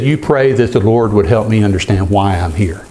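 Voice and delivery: usual speaking voice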